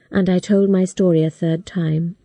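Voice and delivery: ordinary delivery, nothing marked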